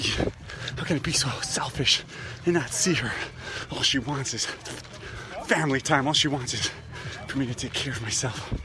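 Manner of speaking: loud, high, accusing outburst; furious accusing rant mid-run; persecutor mode. Real, not acted. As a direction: blame her at full volume. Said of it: "Now I'm gonna show you a persecutor".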